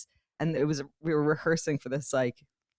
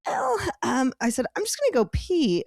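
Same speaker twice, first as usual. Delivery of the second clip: much higher, strained, tight-throated voice; choked-up cover; 7 semitones higher than usual. Retold or imitated. Fighting tears at the photo shoot, high strained excuse. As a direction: hide a lump in your throat with a bright excuse. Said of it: "and I go".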